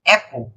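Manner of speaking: Apple said as English "AP-pol", open a; English pronunciation. Shown as a demonstration. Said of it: "olha, apple, qual é o som?".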